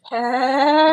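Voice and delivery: high, loud rising parrot call; swelling squawky greeting; parrot impression. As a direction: draw it out like a bird calling. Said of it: "And he'll go".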